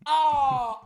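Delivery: normal talking voice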